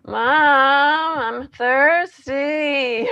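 put-on high, drawn-out whiny kid begging; child's whine. Shown as a demonstration. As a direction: whine it like a thirsty little kid. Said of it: "that cry becomes a whine".

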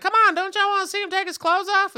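high, loud egging-on voice; hammed-up shouting heckler; imitating rowdy fan. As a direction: rile up the crowd. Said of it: "he was like".

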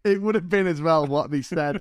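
normal talking voice

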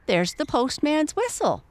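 high, startled exclamation; excited cry. A narrator's reading. Mother hears the postman coming, excited cry. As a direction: call it out with sudden high excitement. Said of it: "she cried".

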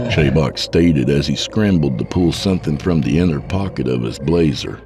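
ordinary delivery

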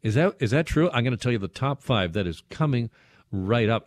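normal talking voice

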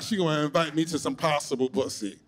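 ordinary talk, nothing marked

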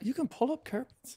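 put-on high, hushed clueless rich kid; wide-eyed disbelief, quietly; naive rich kid. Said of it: "It's like".

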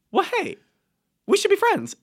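very high, bright mock of a pushy man; squeaky pushy guy voice; imitating guy. Friend mimics the man asking to be friends, very high. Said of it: "And he goes".

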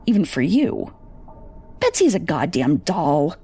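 plain reading voice

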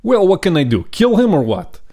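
put-on high, heavy Russian accent on the father's words; mock Russian accent. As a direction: play the Russian dad with a thick accent. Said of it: "a Russian accent".

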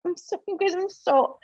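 holding back tears; on the verge of crying, high and strained; choking up. Real, not acted. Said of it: "I start crying again".

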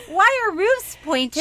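laughing through the question; giggling while asking. Real, not acted.

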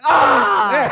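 high, loud mock tantrum scream; frustrated scream. Mad kid answers mom, loud frustrated scream. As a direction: imitate a kid's exasperated cry. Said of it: "I was mad".

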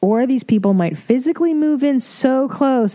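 ordinary talk

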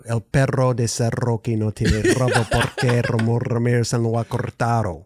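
Spanish tongue twister with rolled r's. Shown as a demonstration. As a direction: rattle off the twister, heavy R rolls. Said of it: "it's all about my tongue twister".

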